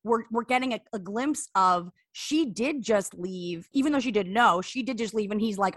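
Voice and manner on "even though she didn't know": ordinary delivery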